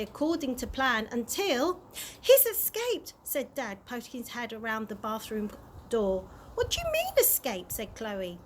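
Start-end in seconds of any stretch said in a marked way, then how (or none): 2.2-3.1 alarmed. Acted. Dad bursts in alarmed. 6.5-7.7 shocked. Acted. Chloe reacts in shock.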